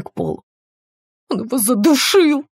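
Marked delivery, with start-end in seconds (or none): 1.3-2.4 high, distraught cry; choking on words. A narrator's reading. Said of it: "захлёбываясь словами, отвечала она". (захлёбываясь словами, высоким криком)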